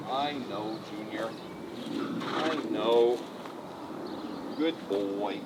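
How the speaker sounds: soft, low murmur to calm him; soothing the horse. Real, not acted. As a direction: settle the horse down gently.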